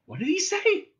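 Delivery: high-pitched astonished question. A narrator's reading. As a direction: ask it in high disbelief, without real whispering. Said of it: "whispered Ford in astonishment".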